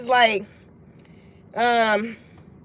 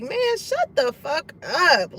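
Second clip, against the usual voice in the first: annoyed; 2 semitones higher, slower than usual. Retold or imitated. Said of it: "in they mind, they like".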